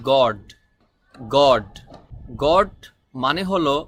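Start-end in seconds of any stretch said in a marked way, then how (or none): 0.1-1.7 গড said carefully, then repeated lower and louder; correct pronunciation. Shown as a demonstration. Teacher shows learners the correct English word, careful pronunciation. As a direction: model গড firmly as a separate word. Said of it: "সঠিক উচ্চারণ হবে".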